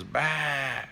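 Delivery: little tiger's stubborn baa; sheep bleat. Acted. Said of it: "And he goes".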